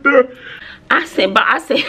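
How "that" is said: put-on louder, higher teen whine; imitating whiny teen. Daughter complaining about too much work, high whine.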